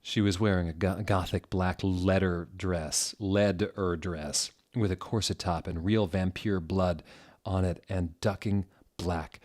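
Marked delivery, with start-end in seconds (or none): none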